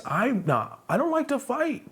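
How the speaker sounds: high-pitched, drawn-up objection; high protest. Retold or imitated. Telling staring guys off, high-pitched protest.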